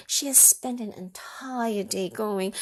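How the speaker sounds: plain reading voice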